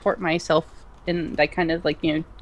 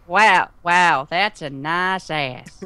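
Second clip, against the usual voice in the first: slow mock admiration; 3 dB louder, much slower than usual. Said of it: "Say it right now".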